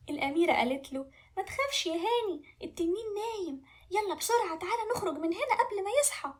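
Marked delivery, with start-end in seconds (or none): 1.4-6.3 high-pitched princess voice; urgent reassurance. Acted. Princess urges frightened prince to leave, high and urgent. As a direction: reassure him, hurrying him along.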